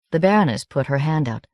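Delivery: usual speaking voice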